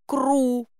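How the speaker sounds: high cooing bird cry; chick's call. A narrator's reading. Chick calling the heroine picky, high bird call. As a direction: coo it high like a baby bird. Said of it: "это означало, что я привереда".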